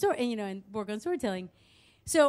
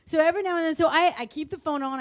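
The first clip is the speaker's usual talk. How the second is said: plain reading voice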